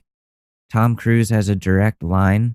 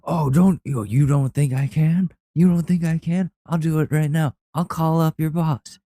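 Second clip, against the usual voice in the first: higher put-on Tom Cruise voice, challenging; mock star daring him to doubt it; Tom Cruise threatening; 7 semitones higher than usual. Tom Cruise threatening to call the IT guy's boss, mock high voice. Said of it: "He's like".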